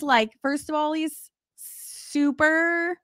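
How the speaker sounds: usual speaking voice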